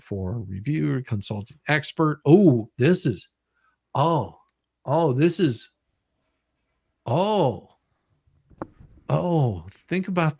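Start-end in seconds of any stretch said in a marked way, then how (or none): none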